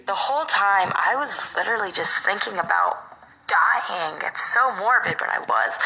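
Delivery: ordinary talk, nothing marked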